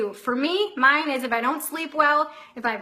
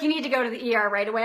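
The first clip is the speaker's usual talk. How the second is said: rattled-off advice; 3 semitones lower, much faster than usual. Retold or imitated. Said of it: "He's like".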